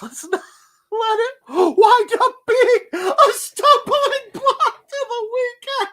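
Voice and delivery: high, loud, slow words through helpless laughter; can barely get it out for laughing. Real, not acted. Greeting chat on Monday, cracking up high and loud.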